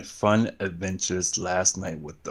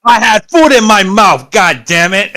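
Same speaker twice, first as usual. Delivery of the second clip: much higher, loud exasperated protest; loud outburst; 10 semitones higher, 13 dB louder than usual. Real, not acted. Host caught with food in the mouth as recording starts, loud outburst. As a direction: blurt it out loudly, pitched way up.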